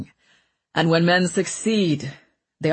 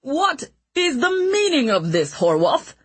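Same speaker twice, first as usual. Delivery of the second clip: indignant outrage; 10 semitones higher than usual. Acted. Ratcliffe indignant at arrest.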